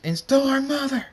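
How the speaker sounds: high, loud furious shriek; screamed accusation. A narrator's reading. Ariel accuses Draconix over their mother, screaming. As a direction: scream the blame out. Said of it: "Ariel screams".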